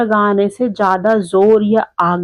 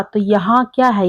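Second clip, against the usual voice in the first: normal talking voice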